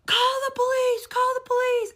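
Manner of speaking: high, urgent shout for the police; imitated loud yelling. Yelling woman coming down the aisle, imitated loud shout. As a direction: mimic someone yelling as they come closer. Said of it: "And it's getting louder".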